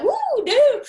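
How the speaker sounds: high, loud laughing recoil; a man's horrified laughing no; mock horrified laugh. Men recoiling at having child.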